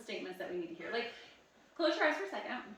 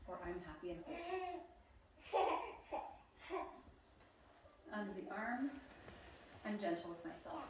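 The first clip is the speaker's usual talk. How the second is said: soft affirmations; 3 semitones higher, 7 dB quieter than usual. Shown as a demonstration. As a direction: murmur each affirmation gently to yourself. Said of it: "whisper this to yourself".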